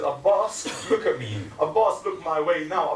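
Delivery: loud, high calling of his name; sister's command. Acted. Zainab to her brother, loud and insistent. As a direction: call him to look at her. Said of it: "She says".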